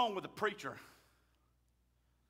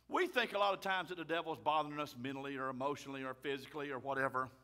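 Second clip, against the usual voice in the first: normal talking voice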